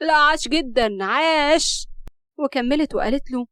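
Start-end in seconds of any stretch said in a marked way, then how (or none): 0.1-1.9 loud, mocking bravo; sarcastic praise. A narrator's reading. Rahaf praises Murad's excuse, sarcastically. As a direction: applaud him with scorn.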